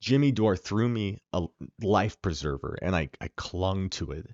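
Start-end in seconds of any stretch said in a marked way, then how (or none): none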